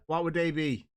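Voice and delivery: ordinary talk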